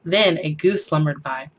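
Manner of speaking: ordinary delivery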